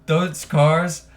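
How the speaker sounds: put-on loud, slurring drunk race fan; town drunk. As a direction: whoop it up like a drunk at the track. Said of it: "he's just slurring his words".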